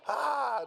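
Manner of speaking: high, loud cry of pain; mock scream. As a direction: scream out as if slashed. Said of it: "Nanjo screams".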